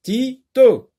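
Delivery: "Tito" high and loud, as "ti... to"; split into syllables. Shown as a demonstration. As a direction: punch out "Tito" in two separate syllables. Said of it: "Deux syllabes".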